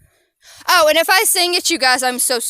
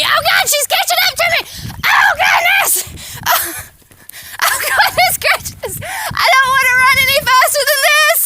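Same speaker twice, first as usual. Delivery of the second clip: breathless panicked cry; 12 semitones higher than usual. Real, not acted. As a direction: gasp it out while racing the dog. Racing her dog uphill.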